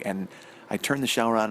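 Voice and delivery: ordinary talk, nothing marked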